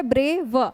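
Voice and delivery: Brave in two separate beats, ब्रे - व; split in two. Shown as a demonstration. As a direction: pause briefly before the final व in brave. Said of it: "ये आपके दो syllable हो गए".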